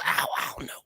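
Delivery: scared hushed whisper. Retold or imitated. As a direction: whisper it back nervously. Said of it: "She goes".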